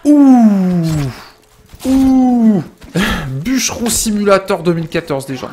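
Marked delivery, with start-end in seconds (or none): 0.0-2.6 high loud whoop. Real, not acted. Fighting enemies in game.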